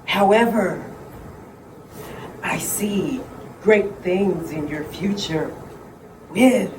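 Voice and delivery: slow, hushed mystic seer voice; fortune teller. Acted. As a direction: intone it like a séance medium.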